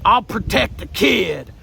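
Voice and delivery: slow, mock-heroic vow; Trump. Trump shielding a sick child, mock-heroic voice. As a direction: vow it slowly, with put-on heroism. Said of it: "And Trump said".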